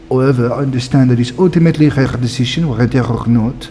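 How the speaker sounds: put-on French-accented game noble reading dialogue. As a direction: play a formal character with a French accent. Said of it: "These people are super French".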